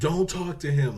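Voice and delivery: loud, high acted shout; urgent warning. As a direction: shout it across to cut them off. Acts detective shouting a warning.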